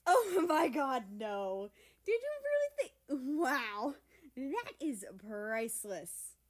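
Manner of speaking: gasping through big laughter; laughing. Acted. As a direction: barely get words out for laughing. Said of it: "gasped it out between laughs".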